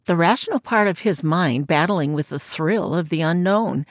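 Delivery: plain reading voice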